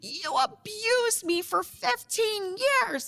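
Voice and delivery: high, slow mock-furious accusation; irate. Wife accusing her ex as he chases her, irate. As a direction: draw out the outrage way up high. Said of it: "just irate".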